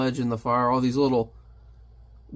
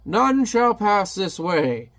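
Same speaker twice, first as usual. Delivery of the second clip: slow, higher, booming villain warning; ominous guard; 6 semitones higher, 3 dB louder, much slower than usual. Retold or imitated. The guard telling Hellboy he cannot pass, slow and menacing. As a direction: lay down the law like a doorkeeper monster.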